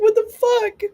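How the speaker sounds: high, loud disbelief; sudden shocked what the fuck; shocked outburst. Retold or imitated. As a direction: blurt it out in total disbelief. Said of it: "And I'm like".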